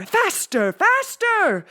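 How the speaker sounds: crazed teacher urging harder; building frenzy. A narrator's reading.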